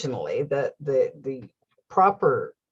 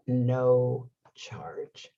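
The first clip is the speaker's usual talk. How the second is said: whispered news; 4 semitones lower, 4 dB quieter than usual. Retold or imitated. Mechanic reveals repair is free.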